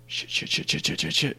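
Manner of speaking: frantic whispered curses; panicked hushed swearing. Acted. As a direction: hiss the curses under your breath.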